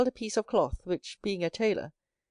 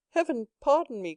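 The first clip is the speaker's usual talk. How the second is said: pleading cry, pitched higher; cried out; 6 semitones higher than usual. A narrator's reading. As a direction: cry out for forgiveness. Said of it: "cried Edmond".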